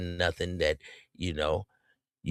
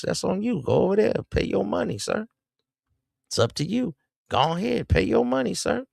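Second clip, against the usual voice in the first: sarcastic go-ahead, pitched much higher; mock encouragement; 12 semitones higher than usual. Host tells tricking men to pay up, in a high sarcastic voice. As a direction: wave them off with high mocking cheer.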